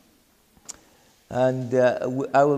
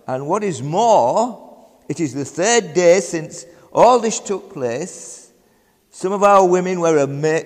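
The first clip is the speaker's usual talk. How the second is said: fervent scripture reading; 4 semitones higher, 5 dB louder than usual. Acted. Reads scripture with fervour.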